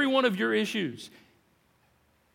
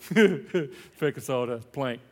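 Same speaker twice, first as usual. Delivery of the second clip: chuckling; 6 semitones lower, much slower than usual. Real, not acted. Preacher chuckling at the joke.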